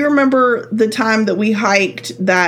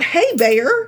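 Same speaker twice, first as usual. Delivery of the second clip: light, friendly "Hey, bear" with a rise; 7 semitones higher than usual. Retold or imitated. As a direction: greet the bear like a buddy. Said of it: "Not, not".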